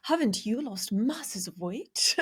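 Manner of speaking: slow, drawn-out mock British accent. Host quotes the queen to co-host, British accent. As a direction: put on a British accent, stretching each word. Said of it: "should I do it in a British accent?".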